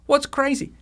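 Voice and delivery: a sincere burst of wonder; amazed. Real, not acted. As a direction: lift it high like a sudden realization. Preacher struck by irony.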